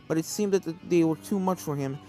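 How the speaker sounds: ordinary delivery, nothing marked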